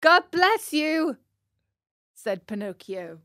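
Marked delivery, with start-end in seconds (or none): 0.0-1.2 put-on high, loud, slow boy puppet voice; eager little marionette voice; puppet boy's blessing. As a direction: call it out high and drawn out. Pinocchio's voice answers the sneeze.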